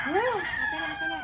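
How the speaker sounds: excited. Real, not acted. Excited at the laundry.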